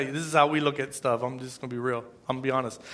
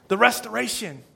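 usual speaking voice

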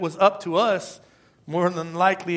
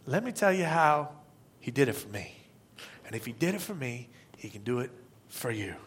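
low and much softer, drawn in close; a lowered, soft personal witness; hushed testimony; 6 semitones lower, 10 dB quieter than usual. Real, not acted. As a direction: bring it down to a near hush for them. Intimate close of the point.

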